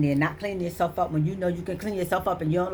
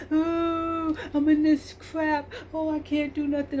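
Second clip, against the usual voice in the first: high, slow whiny voice; mock whining; 10 semitones higher, slower than usual. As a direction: whine like someone wallowing. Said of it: "you sitting up there".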